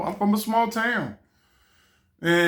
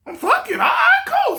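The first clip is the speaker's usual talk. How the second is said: much higher, loud cry of "I'm fucking all right, cool"; overjoyed yell; 3 dB louder than usual. Retold or imitated. Nephew shouting eager agreement at his aunt, overjoyed. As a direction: blurt it out, sky-high with joy.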